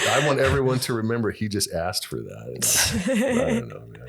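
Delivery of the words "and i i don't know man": a high, cracking New York cabbie whine; a New York cabbie giving up, voice breaking; New York cabbie with a comic crack in the voice. Acted. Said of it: "when I get into character".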